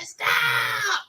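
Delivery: very high stretched-out cry; yelling. Retold or imitated. Boy screaming at mother mid-whipping, high drawn-out yell. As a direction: squeal it out long and high. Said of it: "I started yelling".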